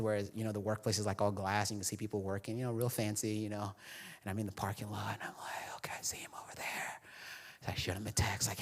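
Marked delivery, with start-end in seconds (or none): 4.2-7.0 whispered, sneaking in the parking lot; hushed aside as if hiding. Retold or imitated. Pastor hiding in the parking lot, whispering. Sneaking up outside friend's workplace.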